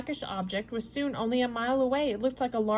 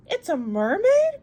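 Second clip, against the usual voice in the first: much higher and louder, slow, amazed question; astonished cry; 10 semitones higher, 9 dB louder, slower than usual. Acted. Servant Conseil cries out to the professor, astonished. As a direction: Conseil gasps it out, thrilled. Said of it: "cried Conseil".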